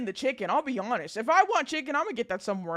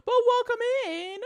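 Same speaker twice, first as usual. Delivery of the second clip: excited greeting; 12 semitones higher than usual. Real, not acted. Greeting raiders.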